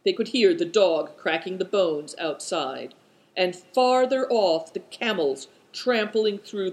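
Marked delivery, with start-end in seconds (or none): none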